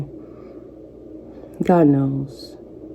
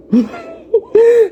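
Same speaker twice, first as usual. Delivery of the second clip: laugh through tears. Real, not acted. Laughing through tears at her own advice, high laugh. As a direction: let a teary laugh burst out.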